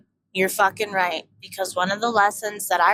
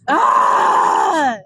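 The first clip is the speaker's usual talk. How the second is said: 1 frustrated yell; 5 semitones higher, 6 dB louder than usual. Real, not acted. Venting frustration mid story.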